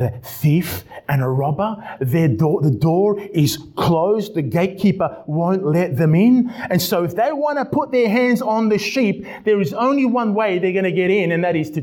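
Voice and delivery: high, emphatic preacher's surge; impassioned preaching. Real, not acted. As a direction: drive the point home with rising pitch. Preaching at full force.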